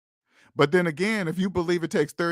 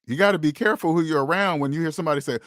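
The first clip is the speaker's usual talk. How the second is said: normal talking voice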